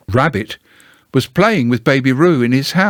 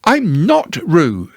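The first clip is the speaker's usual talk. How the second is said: loudly; 7 semitones higher, slower than usual. Acted. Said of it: "said Piglet loudly".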